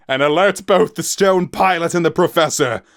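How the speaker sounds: captain calling orders to Tem across the deck; called out. Acted. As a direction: call the order over the wind. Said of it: "Cloud Wolf called out".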